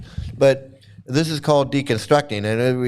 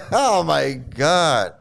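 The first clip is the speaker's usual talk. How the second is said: laughing; 3 semitones higher, much slower than usual. Real, not acted. Laughs after mimicry.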